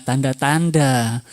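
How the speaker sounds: ordinary talk, nothing marked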